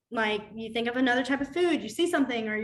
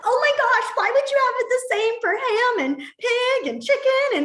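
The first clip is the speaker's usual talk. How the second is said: high, loud, horrified mock outcry; imitating a horrified teacher colleague; 12 semitones higher, 6 dB louder than usual. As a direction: gasp it out like you can't believe it. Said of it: "She's like".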